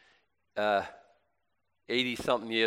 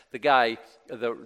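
normal talking voice

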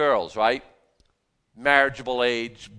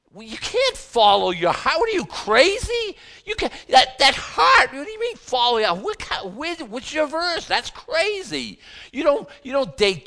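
much higher and louder, incredulous outburst; shocked Bible girl; 12 semitones higher, 5 dB louder than usual. Acted. Bible girl scolding her friend, shrill disbelief. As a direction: scold a friend as if she's lost her mind. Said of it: "Let's call her Bible girl".

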